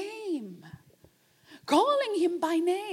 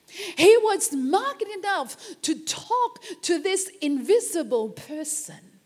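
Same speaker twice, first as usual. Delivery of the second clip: ordinary talk, nothing marked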